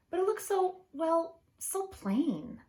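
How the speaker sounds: high, drawn-out doubtful musing; doubtful crab. Acted. Crab judging his new shell, doubtful and high. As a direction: crab frowning at a plain shell. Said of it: "thought Hermit Crab".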